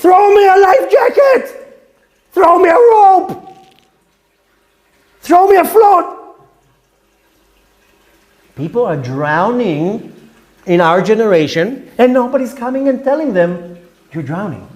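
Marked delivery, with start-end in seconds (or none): 0.0-6.1 high, loud drowning man's cry for help; pleading yell from the water; desperate scream. Pretend. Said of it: "The person who screams". 14.1-14.7 much lower and softer, ominous; hushed "You're drowning."; low grave warning. Acted. Quoted warning, dropped low.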